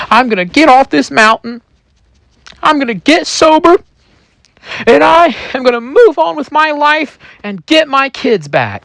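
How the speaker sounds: high, strained declaration; rising, determined proclamation in character; acted resolve. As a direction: build to a high-pitched vow.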